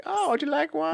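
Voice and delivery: put-on high sugary old lady voice; mock a kindly old apple seller; sweet old lady. Offering an apple as old lady, high mock voice. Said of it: "It's like".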